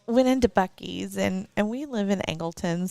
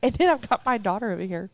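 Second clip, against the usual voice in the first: ordinary talk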